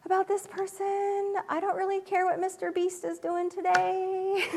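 high, weary mock complaint; mother groaning about YouTube videos; exasperated mom. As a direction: sound fed up with the kids' videos. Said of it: "there are sometimes I'm like".

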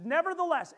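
nevertheless high and loud; stressed nevertheless. Retold or imitated. Preacher quoting the passage, nevertheless high and loud. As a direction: lean hard into nevertheless. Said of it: "that's what it says in the passage".

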